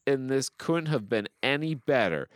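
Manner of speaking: high-pitched, emphatic praise; gushing review read-out. Acted. Host reading praise of Austin Butler, hammed-up gushing. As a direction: declaim it like a superfan.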